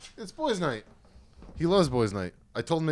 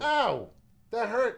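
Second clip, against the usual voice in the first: higher sharp "ow" of surprise pain; sudden yelp; 11 semitones higher, much slower than usual. Real, not acted. Man yelps when his dog's claw scratches him. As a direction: yelp as the claw catches you.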